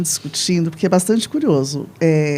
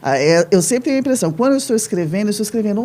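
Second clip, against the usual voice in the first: normal talking voice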